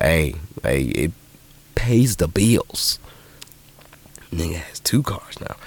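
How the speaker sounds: slow secret whisper; conspiratorial whisper; whispered aside. Real, not acted. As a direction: lean in and whisper it smugly. Sly aside about money.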